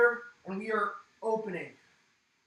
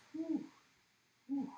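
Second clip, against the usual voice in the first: breathy whew; 8 semitones higher, 8 dB quieter than usual. Real, not acted. Exhaling after the exercise.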